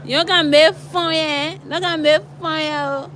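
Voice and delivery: high teasing Liberian English; Liberian slang. Retold or imitated. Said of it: "they can say".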